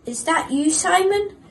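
a frightened shepherd asking, voice going up; shaky voice. Acted. Jacob asking the unseen voice, shaky and nervous. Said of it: "asked Jacob in a shaky voice".

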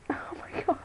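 breathless whisper. Real, not acted. Owner whispers in disbelief at the dog.

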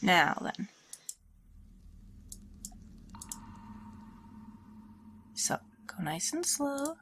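scratchy sore throat voice; talking through a sore, scratchy throat. Real, not acted. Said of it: "apologies if I sound, like, scratchy".

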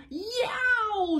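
high storybook yelp of pain; long, high "yow"; cried-out yelp. A narrator's reading. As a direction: yelp high, as if just bitten. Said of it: "she cried".

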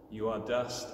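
ordinary talk

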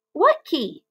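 high puzzled call; shouted question. Acted. Roly-poly bird calling back from the roof, shouted. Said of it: "shouted the roly-poly bird".

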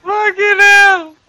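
very high, loud, slow squeal; high-pitched outcry. Real, not acted. Player exclaiming at the game, squealed outcry.

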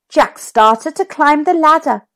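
plain reading voice

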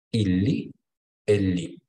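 "il lit, elle lit" with the T dropped, "lit" ending on a bare "i"; "elle lit" ending cleanly on the vowel; silent final T. Shown as a demonstration. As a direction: say "lit" as "li", no T at all. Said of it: "on ne va prononcer pas la lettre T".

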